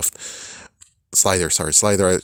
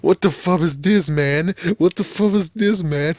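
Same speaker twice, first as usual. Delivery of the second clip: incredulous; 9 semitones higher than usual. Real, not acted. Watching a bizarre scene, high incredulous outburst. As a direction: squeal it in total disbelief. Reacting to shocking video clip.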